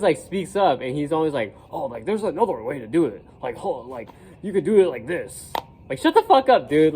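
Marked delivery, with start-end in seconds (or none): 1.7-5.5 put-on voice of a know-it-all butting in; know-it-all classmate. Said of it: "like, a know-it-all in class". 5.9-6.9 high, loud retort to an absent know-it-all; exasperated outburst. Retold or imitated.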